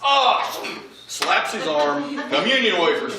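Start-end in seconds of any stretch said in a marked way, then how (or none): 0.0-0.4 short, high, loud yelp; mock yelp of sudden alarm; startled yelp. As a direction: let out a quick acted cry of shock. Dad's retold startled reaction.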